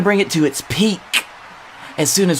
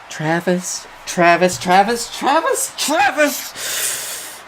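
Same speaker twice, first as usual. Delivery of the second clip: each call climbing higher until the last goes breathy; 3 semitones higher, slower than usual. Acted. Brother pleading for Travis to answer, calls rising in pitch. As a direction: coax him to answer, rising each time. Calling brother's name backstage.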